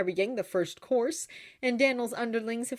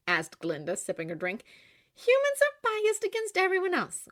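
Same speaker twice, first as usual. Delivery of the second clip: high, light Glinda; 13 semitones higher, 5 dB louder than usual. A narrator's reading. Glinda explaining to Oscar, high and bright.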